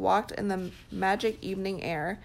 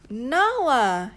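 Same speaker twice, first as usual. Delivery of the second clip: joyful, louder call; joyful cry; 4 semitones higher, 7 dB louder than usual. Acted. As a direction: call her name with glad surprise. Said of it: "he cried joyfully".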